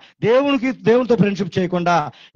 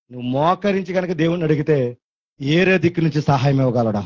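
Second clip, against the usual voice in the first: ordinary delivery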